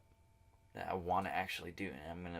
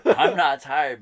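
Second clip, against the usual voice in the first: slow, loud, laughing through words; 6 semitones higher, 15 dB louder, slower than usual. Real, not acted. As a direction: laugh out the realization. Laughing at his own realization.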